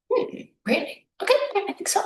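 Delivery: high, light pondering voice; imagined client's high-pitched musing; pondering aloud. Acted. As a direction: muse it up high, then agree readily. Acting out client picking a career.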